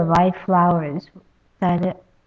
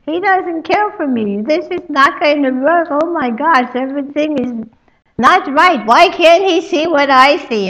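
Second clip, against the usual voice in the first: tearful lament; 8 semitones higher, 4 dB louder than usual. Acted. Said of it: "and then she starts to cry".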